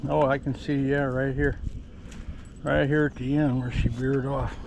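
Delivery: ordinary talk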